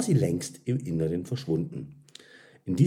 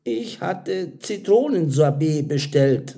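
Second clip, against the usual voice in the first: higher-voiced, tentative objection; hesitant; 8 semitones higher, 6 dB louder than usual. A narrator's reading. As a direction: point out the mistake hesitantly. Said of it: "sagte Karin zögerlich".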